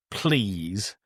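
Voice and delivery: ordinary talk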